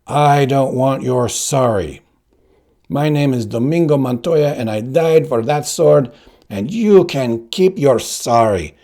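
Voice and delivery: angry father. Acted. The dead father scolding his son, loud and bitter. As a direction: rebuke him with bitter contempt. Father's ghost berates Inigo.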